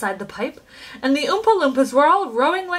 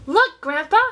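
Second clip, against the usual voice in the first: louder, higher call; eager cry; 5 semitones higher, 5 dB louder than usual. A narrator's reading. Boy calling his grandfather to look, eager cry. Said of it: "cried Charlie".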